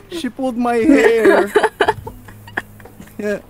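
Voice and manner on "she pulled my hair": slow, high, laughing whine; crying with laughter. Real, not acted. Player complains about pulled hair, crying with laughter. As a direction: whine it out while breaking into laughter. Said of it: "I'm crying while laughing".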